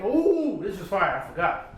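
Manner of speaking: ordinary talk, nothing marked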